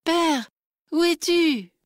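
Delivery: high, pleading cry into the night; lost girl crying out in the dark. Acted. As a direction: call desperately for your father. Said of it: "entendit cette voix et cria".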